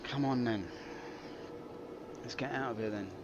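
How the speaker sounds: normal talking voice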